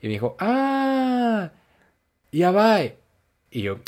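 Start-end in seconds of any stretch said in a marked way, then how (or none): 0.4-2.8 high, slow, drawn-out "Ah" then "ya va"; a drawn-out high "Ah", then a loud "ya va"; mimicked Japanese reply. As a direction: imitate the Japanese friend, much higher and louder. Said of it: "Y se la enseñé".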